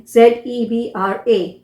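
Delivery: usual speaking voice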